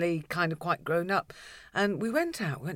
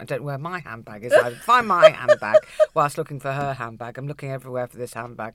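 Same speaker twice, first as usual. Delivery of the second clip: ordinary delivery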